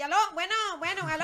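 high, loud mimicked greeting; exaggerated phone pickup; answering the phone. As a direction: call hello into the phone, re-enacted. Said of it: "yo timbre, y".

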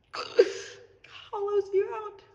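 a broken laugh that turns to tears; laughing through tears. Real, not acted. Vlogger tells followers heartbreak hollows you out, crying through a laugh. Breaking down after emotional lesson.

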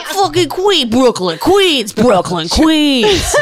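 high, laughing New York caricature; hammed-up Queens and Brooklyn impression; mock New York accent. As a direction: chant boroughs in exaggerated New York accent. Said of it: "She's like".

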